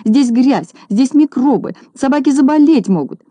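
high, alarmed fussing; acted agitated outcry; crying out. A narrator's reading. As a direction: cry out in high-pitched horror. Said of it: "продолжала кричать Минога".

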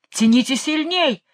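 king's forceful call, not a full shout; thundering command. A narrator's reading. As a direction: urge them on with a rising cry. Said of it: "загремел король".

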